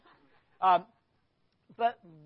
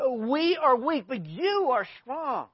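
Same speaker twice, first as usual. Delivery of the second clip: louder, slow mocking contrast; sarcastic; 6 semitones higher, 11 dB louder, slower than usual. Preacher voicing Paul mocking the strong Corinthians, sarcastic. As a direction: jab the contrast home. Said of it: "You hear the sarcasm".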